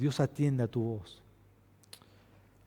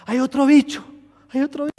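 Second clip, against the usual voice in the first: put-on high, tearful child voice; imitating a frightened daughter; scared little girl; 13 semitones higher, 10 dB louder than usual. Daughter crying again. (imitando a la hija asustada por un bicho)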